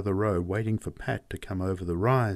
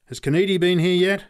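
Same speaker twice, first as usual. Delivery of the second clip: high, loud call; urgent question called from a distance; shouted question; 9 semitones higher, 6 dB louder than usual. A narrator's reading. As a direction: shout up to someone overhead. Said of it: "shouted at one of the men looking down".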